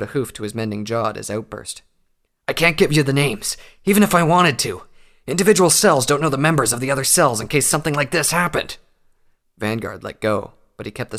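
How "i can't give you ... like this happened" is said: higher, louder desperate plea; urgent pleading. A narrator's reading. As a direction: plead fast to stop her. Prisoner pleads under syringe threat.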